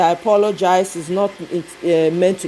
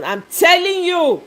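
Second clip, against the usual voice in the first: ordinary talk, nothing marked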